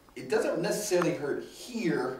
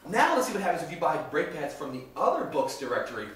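ordinary talk, nothing marked

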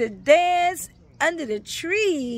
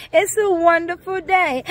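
excited exclamation; 5 semitones higher, 5 dB louder than usual. Acted. Third take raised.

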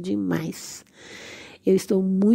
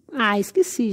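ordinary talk, nothing marked

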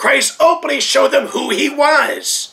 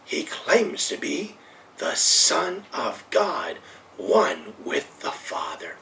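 softer and lower, fading into a whisper; 12 semitones lower, 9 dB quieter, slower than usual. Real, not acted. Preacher to his congregation, fading into a whisper. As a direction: let it fade down to a whisper.

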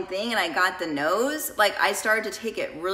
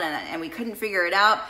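ordinary talk

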